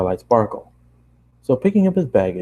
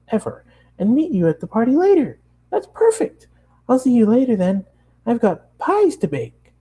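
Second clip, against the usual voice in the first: excited high Pinkie; 12 semitones higher than usual. Acted. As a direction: brighten back up with glee. Pinkie voiced in read story.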